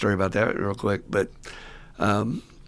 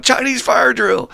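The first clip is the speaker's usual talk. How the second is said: much higher and louder, slow, stretched shout; yelled command; 13 semitones higher, 6 dB louder, slower than usual. Retold or imitated. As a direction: holler it to the whole car. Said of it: "yell out".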